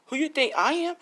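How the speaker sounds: high-pitched, offended comeback; mock-indignant, voice jumping up; indignant retort. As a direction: act out an affronted challenge. Mitsuki answers Bak's challenge indignantly.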